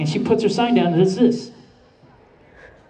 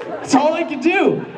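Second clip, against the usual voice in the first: normal talking voice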